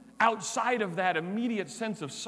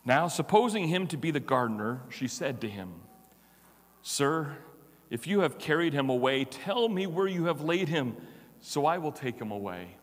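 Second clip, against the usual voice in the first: lower, measured Bible reading; lower-pitched solemn reading of scripture; 6 semitones lower than usual. Acted. Reading the Gospel passage aloud.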